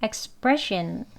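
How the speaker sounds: expression slow, stress on pre; stress on second syllable. Shown as a demonstration. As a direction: stretch expression, leaning on pre. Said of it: "두 번째 음절에 강세".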